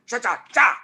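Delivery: loud, high rhythmic "cha" bursts; imitated hip-thrust noise. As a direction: punch out each hip move as a sound. Mimics [name]'s hip moves.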